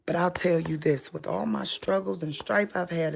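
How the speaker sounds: soft unvoiced whisper. Real, not acted. Confiding to viewers in a whisper. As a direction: lean in and whisper it.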